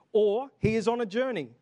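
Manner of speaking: loud, high taunting jeer; mocking. A narrator's reading. Elijah taunting the prophets of Baal, loud jeer. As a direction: call it out in mockery. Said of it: "Elijah mocked them".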